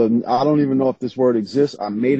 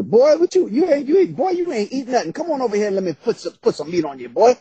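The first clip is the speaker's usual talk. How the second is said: southern motherly grandmother; 12 semitones higher, faster than usual. Acted. Grandmother urging a boy to come and eat, Southern motherly fussing. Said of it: "I hear my grandmother's voice, that southern".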